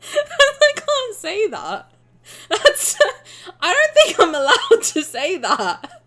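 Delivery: laughing fit. Real, not acted. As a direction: crack up while saying I can't say that. Laughing at word sounding rude.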